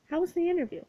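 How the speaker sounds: bright high eager question. A narrator's reading. Kate eagerly asking Hera about her interview. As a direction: pounce on the question.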